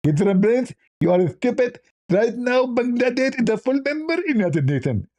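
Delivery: hammed-up high lisp, climbing; Zakir Naik. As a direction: get squeakier as the mock protest builds. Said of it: "Even Zakir Naik, if we call him".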